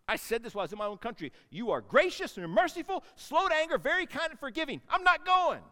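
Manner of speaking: fast, high, loud whining complaint; resentful rant, ending in a high loud refusal; bitter Jonah. Acted. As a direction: spit the praise out as a grievance. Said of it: "that's the tone of voice he said it in".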